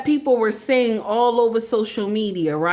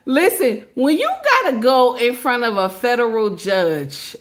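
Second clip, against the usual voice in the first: ordinary talk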